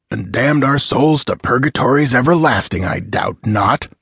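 ordinary delivery, nothing marked

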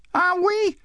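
put-on very high child's voice, pressing her point; insistent little girl. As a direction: reason with Dad in a high, persistent kid voice. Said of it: "ruffling his silence".

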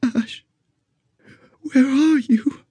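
high, slow dying woman; weak, frightened woman. Acted. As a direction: reach out blindly, fading. Blinded dying woman calls out.

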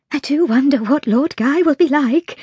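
lightly voiced fretting housekeeper; wondering housekeeper. A narrator's reading. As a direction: voice a spinster housekeeper wondering aloud.

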